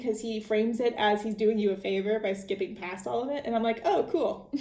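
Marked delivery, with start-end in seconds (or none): 3.8-4.6 amused mock "oh, cool" with a laugh; higher, laughing mock reply. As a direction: toss it off through a chuckle. Sarcastic quip retold, laughing.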